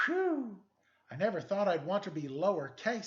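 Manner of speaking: high, loud relieved sigh then line; relieved exclamation. Acted. Rescued from the sign, relieved character to the detective. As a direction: blow out the relief.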